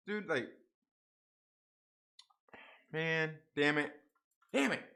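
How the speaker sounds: ordinary talk, nothing marked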